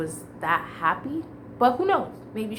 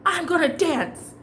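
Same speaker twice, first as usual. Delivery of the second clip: higher, slowed, emphatic "I'm gonna dance"; fed up decision; 7 semitones higher, 3 dB louder than usual. Retold or imitated. Telling herself she will dance at last, determined and raised. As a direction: announce it like you've finally had enough. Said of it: "and I'm finally like".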